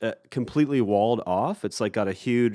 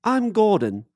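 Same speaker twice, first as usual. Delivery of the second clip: high, louder put-on voice; imitated cheerful reply; 10 semitones higher, 5 dB louder, slower than usual. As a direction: deliver the punch line as the boatman. Boatman's punch line reply.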